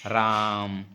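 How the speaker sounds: राम with its vowel held long and low; drawn-out vowel. Shown as a demonstration. Teacher demonstrating a long vowel, held. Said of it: "इस तरीके से प्रदर्शित कर देते हैं".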